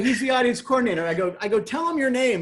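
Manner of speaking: desperate to be believed, pitch up; frantic explaining. Retold or imitated.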